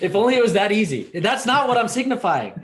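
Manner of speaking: high, loud laughing exclamation; amused. Real, not acted. Joking back to the co-host amid laughter, amused.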